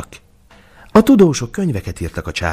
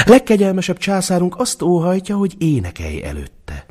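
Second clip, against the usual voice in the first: put-on kitchen girl; 12 semitones higher than usual. A narrator's reading. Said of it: "szólt fel a konyhalány".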